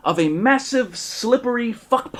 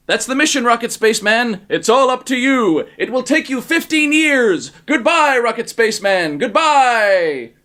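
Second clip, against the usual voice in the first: loud farewell; 5 semitones higher, 5 dB louder than usual. Acted. President sending him off.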